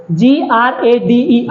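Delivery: letters spelled one by one, slowly; slow spelling out. Shown as a demonstration. Teacher showing pupils how to spell a word, slowly. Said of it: "आपको ऐसे ही पढ़ना है".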